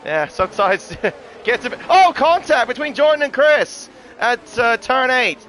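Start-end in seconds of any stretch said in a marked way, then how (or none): 1.9-5.4 excited. Real, not acted. Sudden contact mid-sentence.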